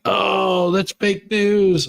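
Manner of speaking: high, slow mocking sneer; mocking dismissal. Streamer mimics critics scoffing at rally video, high slow sneer. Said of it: "people are just like".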